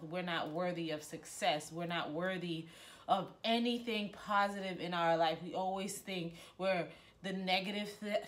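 usual speaking voice

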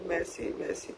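soft, choked-up thank-yous, voice falling away; real emotion catching the throat; moved, quiet thanks. Real, not acted. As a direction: overcome thanks, barely voiced. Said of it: "je suis prise de, d'émotion d'un coup".

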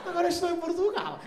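triumphant mock Portuguese accent. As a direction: savour arriving, in a fake Lisbon voice. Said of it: "dei um passinho e falei".

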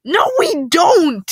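high, loud, drawn-out protest; shouting. A narrator's reading. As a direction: yell back in indignant denial. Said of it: "shouts I'm Wallace".

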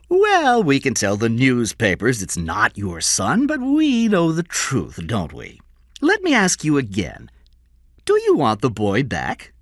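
high, slow, knowing taunt; taunting Score. Acted. Score offers King his son back, taunting high voice. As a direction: draw it out high, loud opening word.

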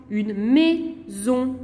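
"maison" drawn out slowly as mai, zon; split into syllables. Shown as a demonstration. Teacher giving a two-syllable example, "maison" broken in two. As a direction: say "maison" in two long, separate beats. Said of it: "Deux syllabes".

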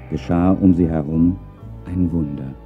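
ordinary delivery, nothing marked

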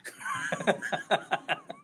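breaks into a high laugh. Real, not acted. Preacher laughing at his parenting skit. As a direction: laugh at your own little skit.

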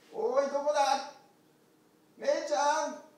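high, loud calling across the hills. Acted. Shepherd calling loudly for the lost lamb. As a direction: shout out to find the lost lamb.

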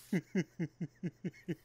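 soft run of short even chuckles; chuckling. Real, not acted. Gamer still amused by teammate Thomas, soft chuckling. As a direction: keep giggling quietly as it winds down.

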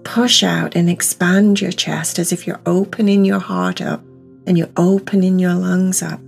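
usual speaking voice

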